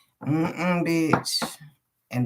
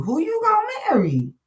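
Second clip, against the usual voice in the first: much higher, singsong nosy asking; mocking family question; faster than usual. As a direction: mimic relatives needling her. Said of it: "my cousin, my brother, my nephew".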